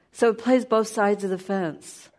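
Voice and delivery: ordinary talk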